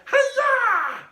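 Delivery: very high, loud chop yell; karate cry. A narrator's reading. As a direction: shriek it as you strike. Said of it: "karate chopped the fly".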